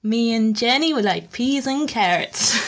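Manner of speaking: slow Alabama drawl; Alabama accent. Shown as a demonstration. Guest shows the only American accent she can do, slow Alabama accent. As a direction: do a slow Southern Alabama impression. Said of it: "the f-, Alabama is".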